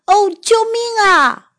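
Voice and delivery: high, frightened call for help; scared cry for help. Acted. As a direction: yelp it out in fear. Scared dog cries for help.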